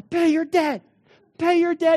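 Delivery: high, loud chant of collectors; demanding shout. Retold or imitated. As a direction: bark it like a hounding debt collector. Collectors popping out in store.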